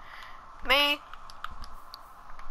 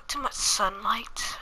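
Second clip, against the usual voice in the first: whispered aside; slower than usual. Real, not acted. Vampire sim complaint aside.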